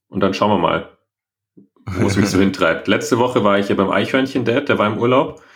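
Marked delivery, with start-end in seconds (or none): none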